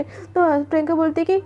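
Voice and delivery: ordinary delivery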